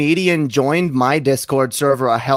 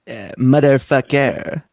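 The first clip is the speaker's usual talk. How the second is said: put-on slow drawled Pimp Monk accent; slower than usual. Defending his accent, slow in the Pimp Monk accent. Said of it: "this is the best, uh, accent".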